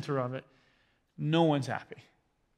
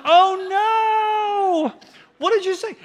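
much higher and louder, half laughing in mock alarm; horrified shout; 10 dB louder, much slower than usual. Preacher retelling his shock at his son's words to the neighbour, laughing mock-alarmed shout. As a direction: yelp it like a dad catching his kid's blunder.

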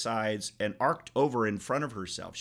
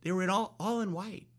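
lightly voiced child, higher and softer; daughter's reply; 8 semitones higher, 3 dB quieter than usual. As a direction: imitate a girl answering simply, pitched up. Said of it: "she goes".

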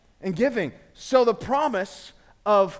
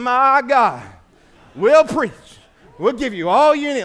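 loud, higher, full-force preacher cadence; preaching; 4 semitones higher, 10 dB louder than usual. Acted. Pastor showing he can really preach, loud and fired up. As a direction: bring it home like a revival preacher. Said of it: "This boy, I'll preach. I'll bring it".

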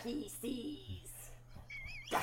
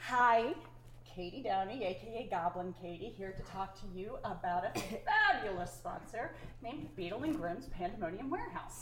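low, fast announcer read; voiceover voice; 6 semitones lower, 5 dB quieter, faster than usual. Acted. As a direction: sell it like an ad. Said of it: "Thank you, voiceover Katie".

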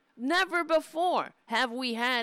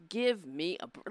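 ordinary delivery

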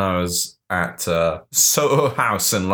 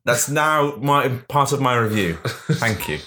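mock indignant call-out; 7 semitones higher than usual. Calls out to Mark Kermode.

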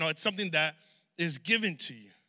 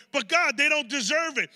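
angels protesting; 6 semitones higher than usual. Retold or imitated.

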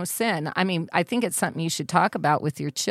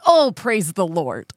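louder, drawn-out pious exclamation; imitating hurt dad; 5 dB louder, slower than usual. Imitating dad who stubbed his toe, loud drawn-out exclamation. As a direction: exclaim praise in place of cursing. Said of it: "when my dad stubs his toe".

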